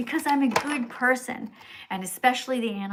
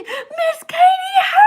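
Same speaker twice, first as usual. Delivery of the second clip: very high, loud, slow shriek; terrified scream for help; 11 dB louder, slower than usual. Pretend. Streamer voicing a doomed pig crying out to her. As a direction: scream it like a trapped piglet begging to be saved. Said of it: "you will scream".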